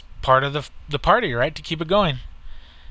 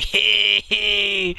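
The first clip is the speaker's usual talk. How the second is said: drawn-out, pitched-up mock cheer; retold cheer; 6 semitones higher than usual. Papi celebrating the Irishman's defeat, imitated long cheer. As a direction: crow in triumph, imitating a friend. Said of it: "And Papi's like".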